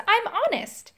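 ordinary delivery, nothing marked

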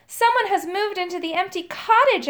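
mildly urgent cry to mother. A narrator's reading. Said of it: "I cried".

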